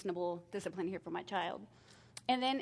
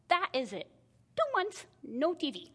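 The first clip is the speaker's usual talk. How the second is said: mock angry outburst; 8 semitones higher, 5 dB louder than usual. Mother lays down punishment.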